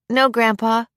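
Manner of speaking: put-on high child's voice; small boy replying to grandpa; young boy. A narrator's reading. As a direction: answer like an obedient little kid. Child answers grandfather's teasing question.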